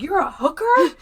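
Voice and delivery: high, loud retold disbelief; mock astonishment. Man learns her job, hammed-up astonishment. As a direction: exclaim it as a scandalized question.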